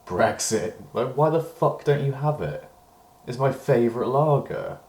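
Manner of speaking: high, loud, indignant outburst; outraged retold rant. Retelling his outburst at the barman, loud and outraged. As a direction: blow up in disbelief at the news. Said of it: "I was like".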